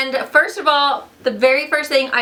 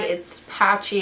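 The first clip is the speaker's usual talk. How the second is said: normal talking voice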